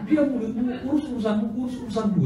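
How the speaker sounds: high, laughing retelling of the retort; rebuke through laughter. Retold or imitated. Preacher retelling his rebuke to the congregation, laughing. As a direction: repeat the rebuke while chuckling.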